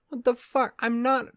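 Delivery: high, loud indignant protest; indignant disbelief. Retold or imitated. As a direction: replay your offended bafflement at full pitch. Acted indignant reaction to commenter.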